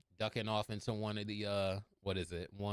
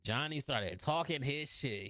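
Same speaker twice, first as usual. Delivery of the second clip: ordinary delivery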